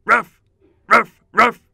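high, loud woof woofs; dog barking. Shown as a demonstration. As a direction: bark like an eager dog. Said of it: "And a few more".

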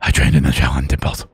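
deep, muffled growl, hard to understand; mumbled gruff voice. Pretend. The cowboy hero taunts his rival in a deep garbled growl. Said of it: "I can't even understand you".

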